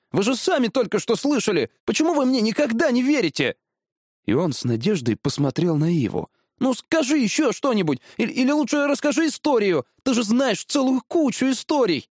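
put-on very high, urgent boyish voice; protest, then beg eagerly; pleading boy. (высокий умоляющий голос мальчика)

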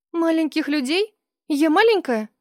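put-on high, offended girl question; indignant. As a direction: sound offended at being called small.

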